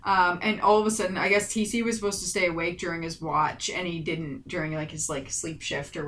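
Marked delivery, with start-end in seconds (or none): none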